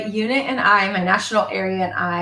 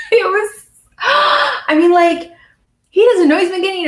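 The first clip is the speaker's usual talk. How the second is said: crying-laugh, high and louder; choked up; 12 semitones higher, 6 dB louder than usual. Real, not acted. Mom tears up retelling her son's praise, voice breaking. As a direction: voice breaking with emotion, real. Moved remembering son's words.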